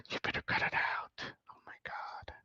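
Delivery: very soft, voiceless whisper; barely audible, real disbelief; stunned whisper. Real, not acted. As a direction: whisper it, overwhelmed and touched. Near tears, stunned by the gift.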